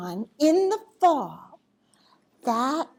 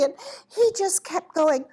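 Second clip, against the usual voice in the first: plain reading voice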